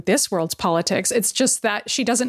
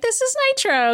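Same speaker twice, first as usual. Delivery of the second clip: Pietro presenting; 14 semitones higher than usual. Retold or imitated. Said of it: "Pietro's like".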